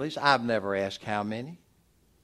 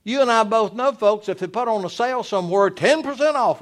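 loud, high-pitched sermon delivery; raised pulpit voice, punching the key words; full-force preaching; 9 semitones higher, 7 dB louder than usual. Real, not acted.

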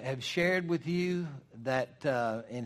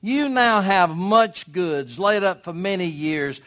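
loud, higher, self-satisfied proclamation; rich fool's boast; 6 semitones higher, 11 dB louder, slower than usual. Acted. Rich man gloating to his soul, loud and slow. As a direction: crow it to your own soul. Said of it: "you see how he said it?".

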